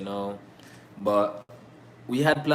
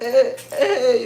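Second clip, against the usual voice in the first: high, loud party "hey"; dancing along; 13 semitones higher, 7 dB louder than usual. Retold or imitated. Dancing to a song not understood, high excited calls. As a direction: call out as if dancing to the beat. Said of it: "I just be like".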